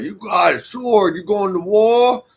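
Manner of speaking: loud, high, drawn-out mock Russian accent. Chef confronting the speaker outside, imitated in a loud Russian accent. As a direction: imitate him with a big, slow Russian accent. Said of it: "he did with a Russian accent".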